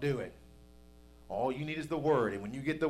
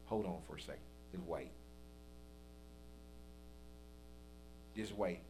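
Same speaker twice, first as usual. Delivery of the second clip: much lower, softer, unhurried; calm Jesus; 10 semitones lower, 16 dB quieter than usual. Retold or imitated. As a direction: hold him off gently and calmly.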